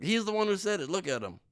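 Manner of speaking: high, loud pointing accusation; mock accuser. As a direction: cry out like someone catching a culprit. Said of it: "be like".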